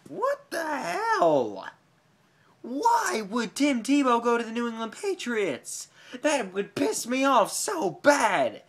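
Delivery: high, slow, whining outrage; outraged whine. Retold or imitated. Fan wailing at the trade rumour, high and outraged. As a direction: wail it like a fan who can't believe it. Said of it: "and I'm like".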